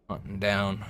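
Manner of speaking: ordinary delivery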